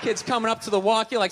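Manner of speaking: ordinary talk, nothing marked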